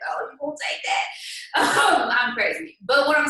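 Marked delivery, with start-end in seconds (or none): 0.1-1.1 higher, teasing taunt; stir-the-pot teasing voice; instigating taunt. Retold or imitated. 1.1-1.9 breathy burst of laughter; real quick laugh. Real, not acted. Laughing at own story.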